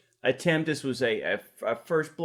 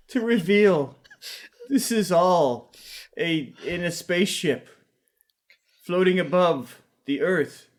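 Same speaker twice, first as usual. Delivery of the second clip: raised, dramatic reveal with pauses; screenplay stage directions; 4 semitones higher than usual. Acted. Reading the camera reveal over laughter, portentous and raised. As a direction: unveil the shot piece by piece.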